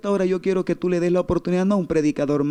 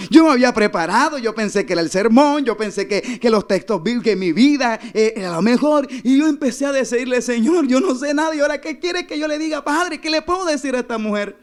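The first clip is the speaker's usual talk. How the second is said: higher, urgent sermon retelling; impassioned preaching; 6 semitones higher, 3 dB louder than usual. Retold or imitated. Pastor retells begging God for words, impassioned preaching. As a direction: preach it with mounting helpless pleading. Preaches his panicked prayer.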